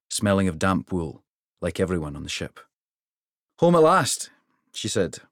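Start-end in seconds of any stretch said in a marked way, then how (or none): 3.6-4.3 bright, loud, high call of homecoming; Regni's loud happy greeting at the rail; Regni eager. Acted. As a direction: cheer it out, glad to be home.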